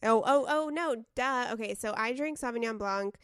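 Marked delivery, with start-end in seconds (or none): 0.0-1.5 loud, quick oh oh oh; sudden realisation. Real, not acted. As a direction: catch yourself out loud.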